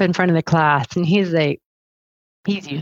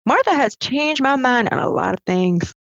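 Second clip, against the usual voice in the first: lightly imitated Western Maryland accent, pitched much higher; a rough try at a Western Maryland man admitting he was wrong; 9 semitones higher than usual. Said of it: "I can't do the accent well".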